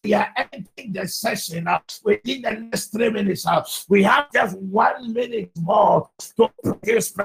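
high, driving shouted intercession; full-force prayer preaching. Real, not acted. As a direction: cry out to God in insistent, chanting bursts. Leads fervent congregational prayer.

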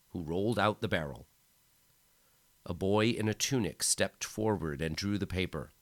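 ordinary delivery, nothing marked

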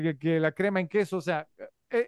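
ordinary delivery

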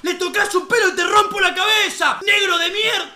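loud, high mock threat; angry yelling. Friends threatening the guard through the fence, loud mock-angry shout. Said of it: "empezaron a gritarle".

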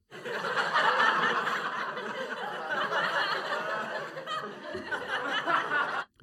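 a long high real laugh; burst of laughter. Real, not acted. Laughing at his own Reagan impression, long high laugh. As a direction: crack up at your own joke.

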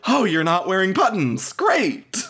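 high mock-cheery voice rising to a squeak; mocking Brett's voice. Podcaster mocking Brett's fake cheer, high and hammed-up. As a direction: ham up his fake delight. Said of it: "he said it in that voice of like".

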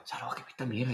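soft, confidential complaint; imitated hushed remark about a stink; retold dancer's aside. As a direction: murmur it aside to a colleague. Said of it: "Me decía una".

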